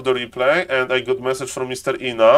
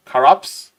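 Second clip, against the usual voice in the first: "Carapace?" clipped to two syllables, "kaps", with a rising tune; broken accent. Shown as a demonstration. Streamer testing his broken accent, a questioning try. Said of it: "the accent is, um, broken enough".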